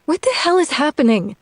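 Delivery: high, quick, bewildered outburst; exasperated. Acted. Woman throwing her hands up at the demon, exasperated.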